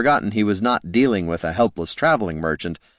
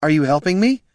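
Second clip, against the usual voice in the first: raised hopeful call; 9 semitones higher, 4 dB louder than usual. A narrator's reading. Calling into the swamp.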